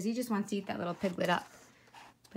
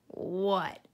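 growled; 2 semitones lower than usual. A narrator's reading. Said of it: "growled the fox".

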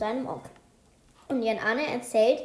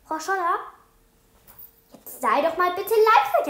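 urgent call; 6 semitones higher, 5 dB louder than usual. Acted. Boy calls out urgently to teacher.

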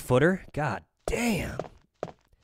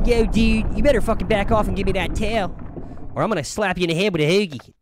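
put-on higher, louder Philly tough-guy accent; mock Philly accent; 7 semitones higher, 4 dB louder, faster than usual. Streamer imitates an angry Philly guy, loud Philly accent. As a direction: give it a hammed-up Philly accent, speeding up at the end. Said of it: "It's kinda like the Philly accent".

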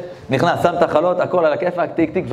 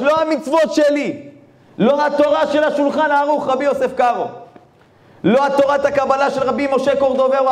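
the Ari teaching the rabbi, higher voice; exalted sage; 9 semitones higher than usual. Acted. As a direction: declare it with awe, much higher. Ari praises the simple man.

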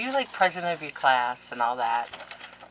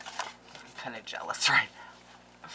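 whisper; 5 semitones lower, 7 dB quieter than usual. Real, not acted. Mock jealousy over daughter's cards.

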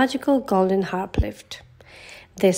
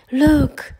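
high, loud exclamation; Mickey spotting the feast; excited cry; 6 semitones higher, 4 dB louder than usual. A narrator's reading. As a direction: point and shout it. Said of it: "Mickey cried".

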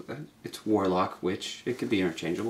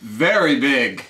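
slow dramatic; 4 semitones higher, 5 dB louder, much slower than usual. Acted. Narration of growth.

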